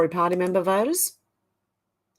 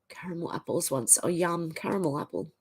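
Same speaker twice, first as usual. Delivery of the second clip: ordinary talk, nothing marked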